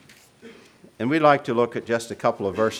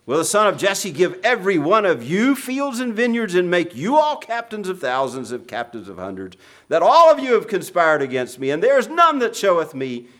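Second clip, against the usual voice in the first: raised, loud impassioned scripture reading; preaching at full force; 5 semitones higher, 6 dB louder than usual. Acted. Preacher voicing David's psalm to the congregation, loud and impassioned.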